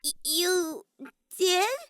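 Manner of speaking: high startled stammer; squeak. A narrator's reading. Himiko caught out by K1-B0, startled high squeak. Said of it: "Kimiko squeaked indiscreetly".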